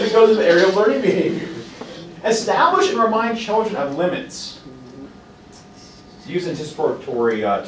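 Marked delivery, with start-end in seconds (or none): none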